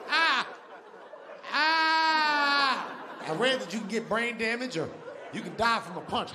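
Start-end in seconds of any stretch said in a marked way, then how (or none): 0.1-2.9 loud, high mock scream; hammed-up scream of sudden blindness; short cry, then a long high wail; panicked scream.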